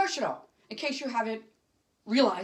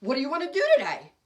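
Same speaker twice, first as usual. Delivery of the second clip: higher, louder, quick and eager; quick, lively invitation; eager question; 4 semitones higher, 5 dB louder, faster than usual. Retold or imitated. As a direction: bounce the question at them, keen to go out. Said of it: "because I would ask them".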